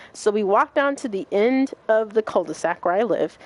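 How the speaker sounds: usual speaking voice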